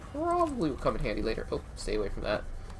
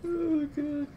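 high and breathless from laughing. Real, not acted. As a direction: gasp it out mid-laugh. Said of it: "That made me laugh".

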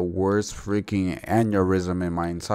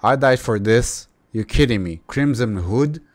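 ordinary talk

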